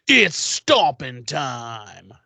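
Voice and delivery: very slow, drawn-out mock battle line; hammed-up action hero. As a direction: savour every word like a movie tagline. Angel vamps out for the fight.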